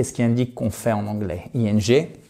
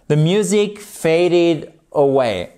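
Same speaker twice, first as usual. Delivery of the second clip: slow model reading; 3 semitones higher, slower than usual. Shown as a demonstration. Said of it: "teacher modelling example sentence".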